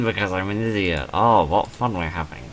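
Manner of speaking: ordinary talk, nothing marked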